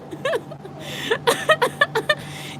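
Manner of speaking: real laugh, pitched very high; bursting into a long high laugh; cracking up high and long at the memory. Real, not acted. Laughing at her own story.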